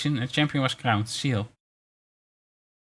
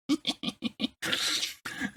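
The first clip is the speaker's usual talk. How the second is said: breathless giggle; 8 dB quieter than usual. Real, not acted. Laughs at a crude pun.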